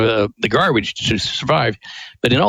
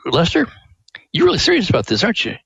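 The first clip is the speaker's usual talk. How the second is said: impressed shop owner; 6 semitones higher, faster than usual. Retold or imitated. Print shop owner voiced.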